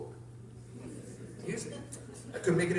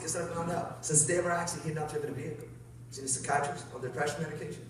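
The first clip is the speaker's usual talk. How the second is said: usual speaking voice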